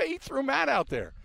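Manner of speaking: usual speaking voice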